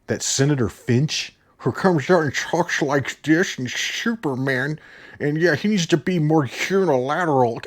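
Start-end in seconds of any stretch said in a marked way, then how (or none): 1.6-7.6 Holly Hunter impression. Movie reviewer mimics an actress he can't stand, mocking slur. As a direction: slur it mockingly through a mouth that barely works.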